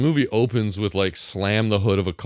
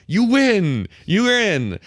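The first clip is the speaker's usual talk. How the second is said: high, raised mock proclamation; mock announcement; 9 semitones higher, 4 dB louder, much slower than usual. Host mocking the movie's easy victories, mock proclamation. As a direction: declare it high like a contest announcer. Voices contest announcers declaring winner.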